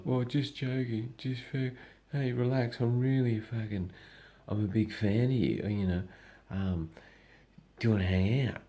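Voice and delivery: high, placating mock-fan voice; backtracking troll.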